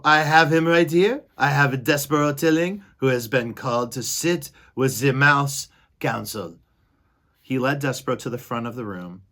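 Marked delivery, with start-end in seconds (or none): 0.0-6.6 loud, proud announcement; Furlow announcing. Acted. Furlow presenting his brother to the council. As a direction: present the prisoner with pride. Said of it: "shouted Furlow".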